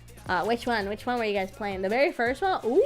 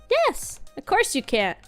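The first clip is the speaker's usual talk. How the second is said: put-on young girl voice; 7 semitones higher, slower than usual. Girl character replies.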